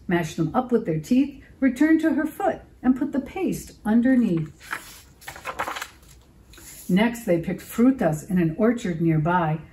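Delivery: usual speaking voice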